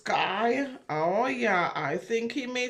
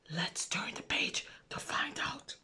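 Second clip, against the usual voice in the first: unvoiced suspense whisper; 9 dB quieter than usual. Reader to children, whispering to build suspense. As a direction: hush it like a secret for the kids. Reading aloud, teasing next page.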